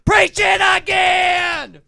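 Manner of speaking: high, slow preacher shout. Retold or imitated. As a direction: bellow it like the pulpit. Preacher bit, egging on.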